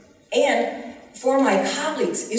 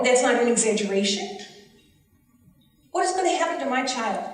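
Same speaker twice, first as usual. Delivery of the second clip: ordinary talk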